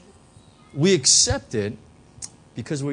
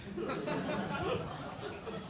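high breathy laugh; 12 semitones higher, 13 dB quieter than usual. Real, not acted. Laughs at own point.

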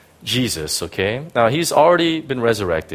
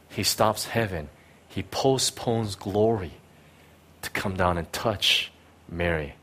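soft, low, gentle; a pastor speaking softly to the broken; tender hushed preaching; 5 semitones lower, 8 dB quieter than usual. Real, not acted. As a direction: comfort the hurting.

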